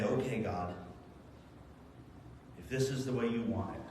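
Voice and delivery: quiet surrender. Acted. Surrendering prayer to God.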